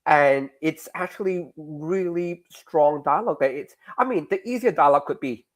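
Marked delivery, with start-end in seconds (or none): none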